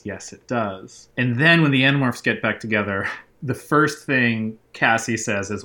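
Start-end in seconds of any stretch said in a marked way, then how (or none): 0.0-1.1 slow, softer inner reply; silent reply. A narrator's reading. Jake answers Visser only in his head, slow and soft. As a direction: say it slow and inward, barely aloud. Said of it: "Jake silently replies".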